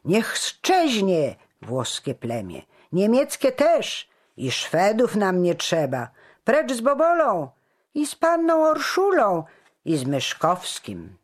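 high, loud mob chant of hate; shouts like bombs. A narrator's reading. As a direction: fire off angry cries from the crowd. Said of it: "krzyki padały jak bomby".

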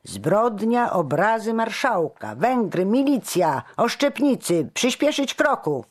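high, loud military commands; thundering. A narrator's reading. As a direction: roar orders at the guards. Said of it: "zagrzmiał dowódca straży".

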